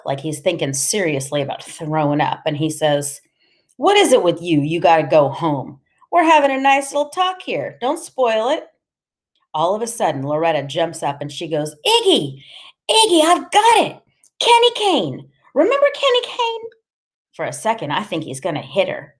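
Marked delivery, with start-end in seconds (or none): 3.8-8.7 lightly voiced bully, rising in pitch; mock-friendly menace, climbing higher; needling gang boss Iggy. A narrator's reading. 11.9-16.7 very high, thrilled outburst; eager high-pitched cry of recognition; excited realization. A narrator's reading. Jumps up with sudden recognition.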